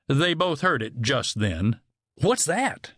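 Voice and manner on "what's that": sudden alarmed question; quick startled ask, voice lifting. A narrator's reading. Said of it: "he asked".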